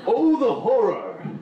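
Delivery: slow, loud melodramatic wail; hammed-up horror lament; dramatic horror. Repeated comic catchphrase.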